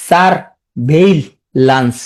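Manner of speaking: "সারভেইলেন্স" in three slow, separate pieces; syllable-by-syllable. Shown as a demonstration. Teacher fixing a common misspelling, slow and broken up. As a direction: pause between "সার", "ভেই" and "lens". Teacher breaks word for spelling.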